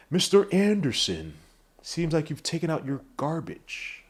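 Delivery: slow, drawn-out, menacingly measured villain voice; movie villain impression. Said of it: "that kind of voice".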